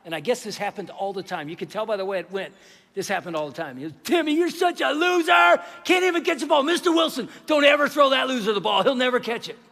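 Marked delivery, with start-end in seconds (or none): 4.0-9.6 high, loud schoolyard taunting; bully's loud, jeering holler; loud mocking kid. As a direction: shout it like a kid jeering across a playground. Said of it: "he made fun of Timmy really loud".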